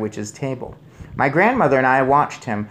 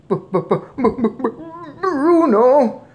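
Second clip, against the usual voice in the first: high stutter bursting into the name; shocked stammer; 6 dB louder than usual. A narrator's reading. Father stammers at his mouse son, aghast. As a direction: splutter until the name breaks out. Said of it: "stammered Mister Jenkins again".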